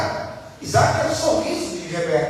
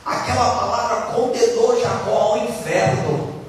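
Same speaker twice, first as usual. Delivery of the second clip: ordinary talk, nothing marked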